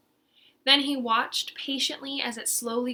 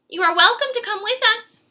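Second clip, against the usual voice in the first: Aggie inviting; 11 semitones higher, 9 dB louder, faster than usual. Acted.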